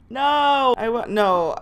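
normal talking voice

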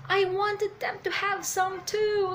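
high, slow acted complaint; drawn-out upset wail of a character; mad. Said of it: "Big Mom again is mad".

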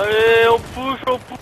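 loud, slow "on push"; rallying call. Real, not acted. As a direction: urge the team forward. Rallying the team.

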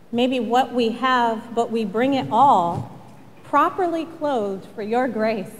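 low, measured pastoral prayer. Real, not acted. Pastor leads the congregation in prayer before reflection, low and reverent. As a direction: pray gently, with unhurried pauses, softening to amen.

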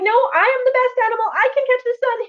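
high, fast insistent raven voice; stubborn raven. Acted. The raven refuses to listen to anyone, high and insistent. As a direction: insist you are the best. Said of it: "He's like".

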